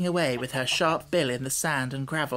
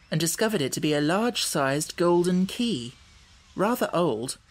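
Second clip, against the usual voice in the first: plain reading voice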